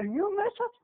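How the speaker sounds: rising take of the warning. Shown as a demonstration. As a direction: lift it up as a question.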